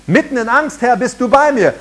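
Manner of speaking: preacher modelling a confident confession; bold declaration. Shown as a demonstration. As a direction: declare it out loud against fear. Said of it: "jetzt fange an zu üben".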